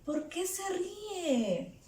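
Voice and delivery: hushed, high-pitched wondering; acted puzzled overhearing; surprised listener. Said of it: "Yo escucho".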